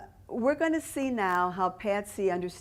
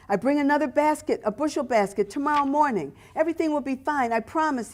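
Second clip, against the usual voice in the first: put-on higher pleading Italian-accented seller; 6 semitones higher, 3 dB louder than usual. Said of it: "He spoke with a thick Italian accent".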